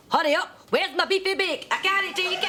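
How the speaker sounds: mock yelling. Canteen worker acting out a desperate customer at the counter, high mock yell. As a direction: play the starving customer begging for his pie. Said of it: "You're standing there yelling".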